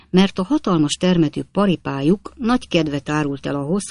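usual speaking voice

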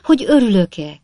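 mock delighted cry; exclaiming. A narrator's reading. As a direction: cry out in eager echo. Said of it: "kiáltott fel Job Trotter".

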